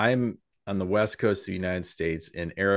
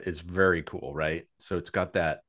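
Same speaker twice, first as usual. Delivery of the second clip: ordinary talk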